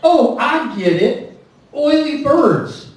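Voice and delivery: slow realization. Retold or imitated. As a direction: play the cashier finally getting the pun. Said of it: "She goes".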